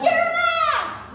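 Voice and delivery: very high mock woman's voice. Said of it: "Or a woman's voice".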